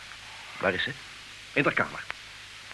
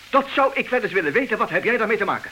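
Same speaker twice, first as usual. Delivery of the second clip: higher, louder indignant shout; angry shouting; 8 semitones higher, 5 dB louder than usual. Acted. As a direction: throw the question back at him in anger. Husband keeps shouting at friend.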